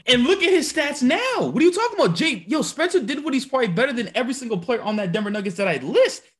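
can't believe what he's hearing; incredulous. Real, not acted. Arguing the stats in disbelief.

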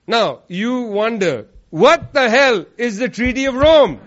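usual speaking voice

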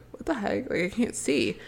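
softly baffled muttering to oneself; puzzled aside. Retold or imitated. Retold puzzlement in the dark.

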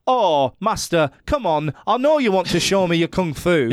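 hammed-up Northern English kung fu dub; Northern English accent.

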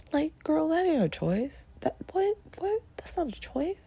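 very high-pitched, incredulous protest; mock indignant disbelief. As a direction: object in squeaky, hammed-up disbelief. Acted outraged reaction to the plot.

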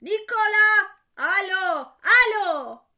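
high, very loud calling into a phone; insistent bellowed hellos; shouting. A narrator's reading. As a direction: keep yelling down the line. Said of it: "diye yine bağırmaya başladı Alcest".

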